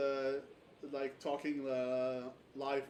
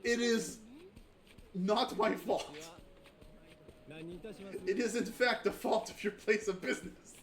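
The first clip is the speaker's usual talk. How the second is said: higher, louder, slow and haughty; indignant formal complaint; 6 semitones higher, 4 dB louder than usual. Acted. Character blames the business, haughty and loud. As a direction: lodge a pompous grievance. Voicing game character dialogue.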